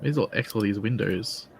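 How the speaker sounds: ordinary delivery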